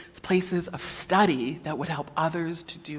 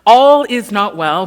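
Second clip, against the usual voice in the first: loud proclamation; 2 semitones higher, 12 dB louder than usual. Acted. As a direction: build to a ringing shout. Sermon refrain, loudest repeat.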